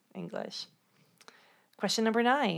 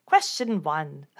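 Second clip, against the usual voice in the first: slow, louder British accent attempt; 2 semitones lower, 6 dB louder, slower than usual. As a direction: try on a British accent, slowly and firmly. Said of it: "do it in a British accent".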